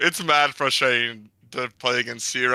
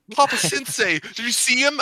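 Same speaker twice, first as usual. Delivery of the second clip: high, cocky gloat; bragging to Papa Sensei; gloating kid; 11 semitones higher than usual. Retold or imitated. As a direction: crow to the teacher. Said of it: "'cause he's like".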